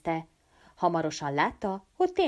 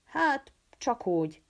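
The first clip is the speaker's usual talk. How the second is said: evasive; 6 semitones higher, slower than usual. Acted. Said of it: "válaszolt a diák kitérően".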